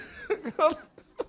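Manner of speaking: high burst of laughter; delighted laugh. Real, not acted. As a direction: laugh out in glee. Laughs after money news.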